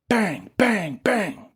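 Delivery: high bang bang bang; gunshots. A narrator's reading. Narrator voices Dylan's rapid pistol shots. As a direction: punch out each shot.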